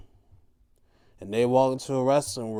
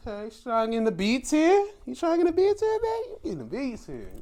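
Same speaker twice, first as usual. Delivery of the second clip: teasing sing-song; 11 semitones higher, much faster than usual. Retold or imitated. Teasing her about the tier.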